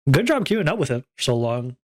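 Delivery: normal talking voice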